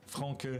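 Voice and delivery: Franck said the French way, uvular r and nasal vowel. Shown as a demonstration. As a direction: say Franck as a French name, fʁɑ̃k. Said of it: "Oh no, that would be awful".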